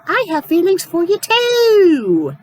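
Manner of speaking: cheesy melodrama in a mock swoon; mocking cliche line. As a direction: overact the sappy confession to mock it. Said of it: "it does the whole cliche".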